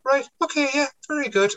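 high-pitched mock encouragement; retold humouring. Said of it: "I was standing there going".

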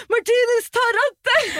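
high, loud yelling for help; panicked shout. Retold or imitated. Wife yells at husband to take the wheel, loud and high. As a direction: yell at him to grab the wheel. Panicked call while driving.

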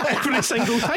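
laughing high, can barely get it out. Real, not acted. Telling the podcast host the running joke, laughing. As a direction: laugh through the words. Laughing at his own story.

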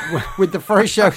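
real laugh breaking into the words; chuckling through the end of the line. Real, not acted. Radio host laughing at his own link.

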